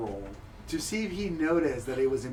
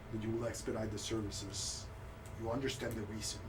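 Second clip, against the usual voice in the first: low, soft, stiff formal speech of a shamed noble; humiliated noble; 6 semitones lower, 10 dB quieter than usual. Acted. Shamed noble telling the cleric his terms, low and subdued. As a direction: hold your dignity while deeply embarrassed.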